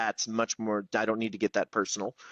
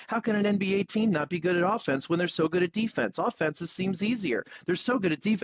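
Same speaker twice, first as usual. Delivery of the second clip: fast, higher, words tumbling out; racing inner monologue; 6 semitones higher than usual. Retold or imitated. Telling mom about his racing brain, fast and eager. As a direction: let the thoughts race and pile up. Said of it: "That's my brain for five seconds".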